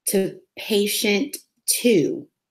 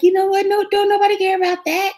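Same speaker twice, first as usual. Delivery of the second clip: high, fast, mocking tirade; hammed-up scold, pushed high and quick; mock angry rant; 11 semitones higher, 4 dB louder, faster than usual. Podcaster acting a man telling his partner off, hammed-up and high.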